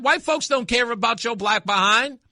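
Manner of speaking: fired-up shout at the people hollering about racism; retold rant. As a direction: hammer it home like a street preacher. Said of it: "I said".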